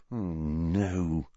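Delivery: low, soft mutter of dismay; quiet groaning aside. Acted. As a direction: mutter it under your breath. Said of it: "muttered Harry".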